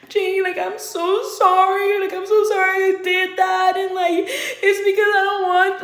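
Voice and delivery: high, slow, drawn-out whine; hammed-up whimpering plea; mock tearful apology. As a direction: imitate a boyfriend blubbering for forgiveness. Acted boyfriend tearfully begging forgiveness.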